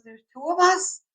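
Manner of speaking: mock surprise. Ali reacting, surprised.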